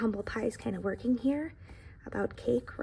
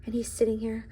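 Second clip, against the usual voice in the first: ordinary delivery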